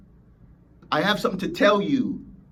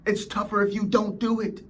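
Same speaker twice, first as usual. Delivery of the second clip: ordinary talk, nothing marked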